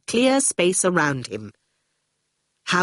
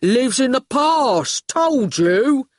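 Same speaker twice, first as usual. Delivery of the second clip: slow, higher, heavy goon voice; 6 semitones higher, 3 dB louder, slower than usual. Acted. Goon corrects Howard in the car, slow reproach. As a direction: spell it out as a lumbering heavy.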